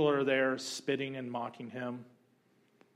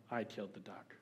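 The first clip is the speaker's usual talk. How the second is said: softer, small ashamed admission; guilty confession; 5 semitones lower, 12 dB quieter than usual. Retold or imitated. Boy confessing to his grandma, soft ashamed voice. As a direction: own up, head down.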